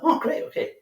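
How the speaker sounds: leaps high on "Oh, great", half-breathed; airy, high, keen reaction; mock excitement. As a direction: play up eager interest for a mate. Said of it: "I'm like".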